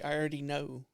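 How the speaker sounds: hushed, knowing computer voice; Hal. Acted. Computer reassuring its owner it knows his searches, soft machine voice. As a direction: say it softly as an all-seeing machine. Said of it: "it's gonna be Hal's voice".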